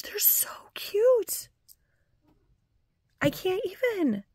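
overwhelmed, thrilled squeal over a thoughtful present; gushing with delight. Real, not acted. Gushing to viewers over a surprise birthday gift, squealing.

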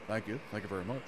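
low, soft [name] mumble; a lightly done [name] thank-you; [name] impression. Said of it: "and Elfish, the king".

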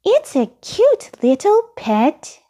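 high-pitched little puppet voice; high, childlike character voice; Elmo. A narrator's reading. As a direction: speak way up high as a small eager character. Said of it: "said Elmo".

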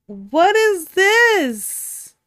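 loud, drawn-out high disbelief; what is THIS, stretched and shouted; real shock at a game twist; shocked outburst. Real, not acted.

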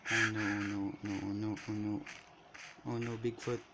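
low, fading oh nos; quiet panic. Real, not acted. Gamer sees Bigfoot approach, quiet fading panic. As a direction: mutter in growing dread.

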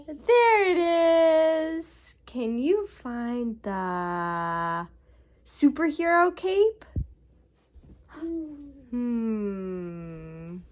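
put-on slow, high sing-song to a toddler; reading to a toddler. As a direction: draw the little one into the game. Seek-and-find game with toddler.

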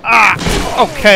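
usual speaking voice

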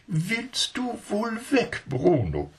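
high, slow, scolding lady's voice; a prim lady telling off a monkey; strict lady. Acted. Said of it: "sagte sie sehr streng".

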